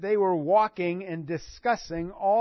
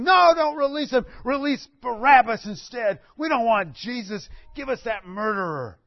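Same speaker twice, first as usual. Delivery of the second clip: mock crowd yell, louder and higher; screaming crowd; 5 semitones higher, 6 dB louder than usual. Preacher voicing the crowd against Pilate, mock yell. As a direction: cry out like an angry mob. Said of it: "people started screaming".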